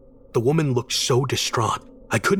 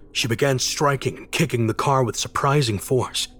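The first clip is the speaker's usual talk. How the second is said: ordinary delivery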